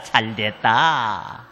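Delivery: slow, drawn-out mock gloat; low slow inner gloat; gloating under breath. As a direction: savour it privately, slowly. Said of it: "난 속으로".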